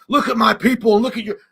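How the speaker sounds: loud, high mock speech; grandstanding politician. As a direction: ham up a politician playing to the crowd. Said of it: "and they're like".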